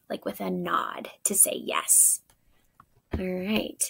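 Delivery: hushed aside to the class; whisper. Real, not acted. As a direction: drop to a hushed whisper to show silent talk. Teacher drops to a whisper.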